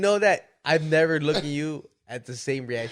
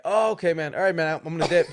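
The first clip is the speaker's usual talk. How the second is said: louder, higher, laughing imitation of his friend backing out; mock nervous friend; 4 semitones higher, 6 dB louder than usual. Telling his friend how he sounded: laughing mock bail-out. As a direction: play the friend hastily bailing with a laugh. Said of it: "And you're like".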